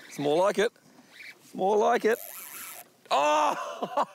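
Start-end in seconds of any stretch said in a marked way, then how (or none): 3.1-4.0 dismayed shout. Real, not acted. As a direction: shout as the fish gets off. Fish lost on the line.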